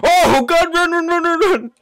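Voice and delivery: high frantic screaming run; panicking gamer. Real, not acted. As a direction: shriek it in pure panic. Fleeing monster, yelling run.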